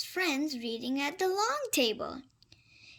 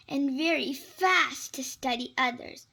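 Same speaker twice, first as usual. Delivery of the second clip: ordinary delivery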